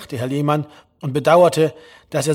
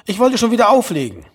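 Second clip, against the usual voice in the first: higher, louder mother cutting in; insistent mother; 6 semitones higher, 6 dB louder than usual. A narrator's reading. Mother talking over her son on the phone, insistent. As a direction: push over the son's word, pitched up.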